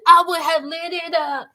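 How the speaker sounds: the last one yelled with a sob; crying shout. Real, not acted. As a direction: break into a crying yell. Repeating the threat, final take.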